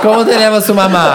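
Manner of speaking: put-on Spanish accent, laughing. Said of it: "ama seu sotaque espanhol".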